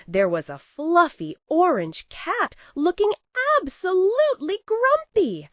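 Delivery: ordinary delivery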